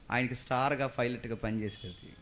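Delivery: usual speaking voice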